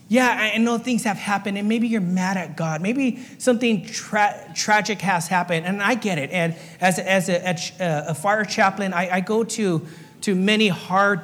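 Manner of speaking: normal talking voice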